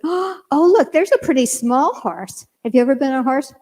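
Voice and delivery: retold eager wonder; coaxing excitement. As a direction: gasp of delight, pitched high at first. Coaxing child toward the horses.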